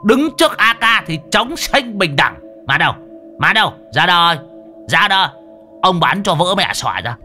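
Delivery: shouting. A narrator's reading. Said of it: "Đồng già hét lên". (hét lên thách thức)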